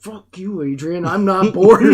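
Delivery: loud laughing retort; mock retort. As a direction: fire it back through a laugh. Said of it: "I'm like".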